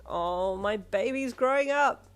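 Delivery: proud dad in the background, happy cry. Acted. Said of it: "her dad cried happily".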